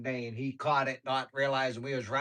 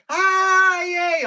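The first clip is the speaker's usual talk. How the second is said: high, loud squealed cheer; imitated scream; 13 dB louder than usual. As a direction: mimic a child screaming on a ride. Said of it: "she's screaming".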